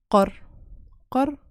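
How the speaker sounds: Qor with a strong throaty Q. Shown as a demonstration. Teacher showing the strong Somali Q in Qor. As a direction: hit the Q in Qor hard from the throat. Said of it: "so it's much stronger".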